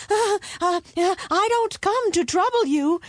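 high, stammering timid voice; meek and terrified. A narrator's reading. Eldest son placates the roaring dragon, meek and frightened. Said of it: "the eldest son said meekly".

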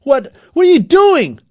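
high, loud stammered outcry; frantic, high-pitched protest; alarmed shout. A narrator's reading. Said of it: "shouted Aaron".

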